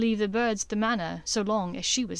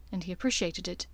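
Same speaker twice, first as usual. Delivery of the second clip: plain reading voice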